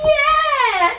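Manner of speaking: high, loud hyper squeal; hyper yell. Real, not acted. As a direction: shriek it, totally wired. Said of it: "I was so hyper".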